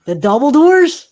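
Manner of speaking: loud, high incredulous question; mock incredulous. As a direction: question their choice with theatrical disbelief. Game master doubts players' choice, escalating.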